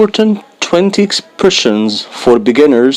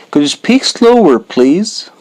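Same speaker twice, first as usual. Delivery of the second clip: quick natural take; 3 semitones higher than usual. Shown as a demonstration. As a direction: rattle it off like a native speaker. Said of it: "slow then fast take".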